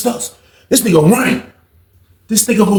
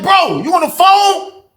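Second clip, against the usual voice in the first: high, drawn-out exasperated question; exasperated demand; 10 semitones higher, slower than usual. Retold or imitated. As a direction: stretch it out, pitched way up in disbelief. Retold demand to a phone hogger.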